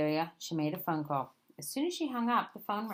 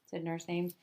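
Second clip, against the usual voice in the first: plain reading voice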